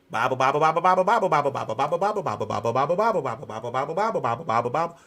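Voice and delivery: rapid repetitive sing-song chant; mock Bible babble. Saying all we ever preach, rapid-fire mimicry. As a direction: rattle it off as an endless loop.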